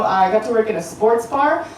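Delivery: ordinary delivery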